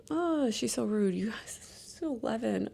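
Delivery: soft, slow, hushed complaint. Real, not acted. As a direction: lean in and complain quietly. Venting about rude preteen daughter.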